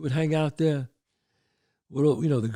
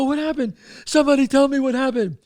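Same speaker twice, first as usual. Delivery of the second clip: high, loud panicked demand; frantic plea; 8 semitones higher, 6 dB louder than usual. Retold or imitated. Panicked man to people sheltering, frantic plea. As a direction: plead loudly with a raised pitch.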